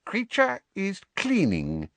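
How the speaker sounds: high, shifty house-elf voice; evasive. A narrator's reading. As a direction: dodge the question, elf voice. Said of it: "he said evasively".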